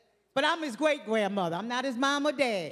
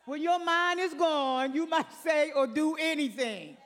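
preaching at full force; 4 semitones higher than usual. Acted. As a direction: repeat the line to drive it home.

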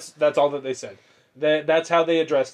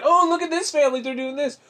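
high-pitched gushing imitation of wife; put-on higher voice, mock enthusiasm; 11 semitones higher than usual. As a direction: mimic her excited chirp about a video. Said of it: "I know my wife, she watches".

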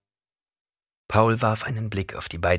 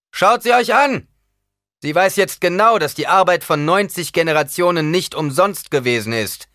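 loud, high-pitched public proclamation; louder; 9 semitones higher, 9 dB louder than usual. A narrator's reading. Paul addressing his comrades about the Reverend Mother, loud. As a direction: call it out to everyone present. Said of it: "Lauter sagte Paul".

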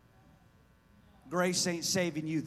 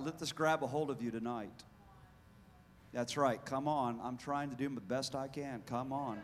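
ordinary talk

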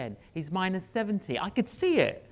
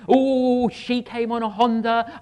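louder, higher hammed-up tongues-speaking; mock charismatic prayer; 4 semitones higher, 7 dB louder than usual. Mocking charismatic praying at a bedside, loud and hammed-up. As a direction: parody an overexcited Pentecostal praying. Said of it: "Pentecostal about it and charismatic".

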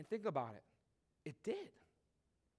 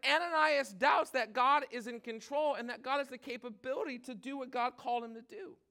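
usual speaking voice

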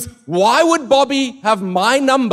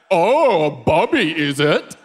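mocking disbelief; much slower than usual. Mocking a supposed prank caller.

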